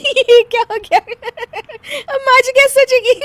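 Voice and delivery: high, loud words through giggles; helpless laughter. Acted. Daughter-in-law fretting over mother-in-law, laughing helplessly. Uncontrollable laughing fit in story.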